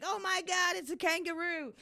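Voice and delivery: high-pitched, awestruck crowd with an Australian twang; mock Australian accent. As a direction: imitate amazed onlookers in an accidental Australian accent. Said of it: "I don't know why I turned Australian then".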